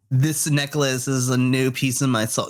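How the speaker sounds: ordinary talk, nothing marked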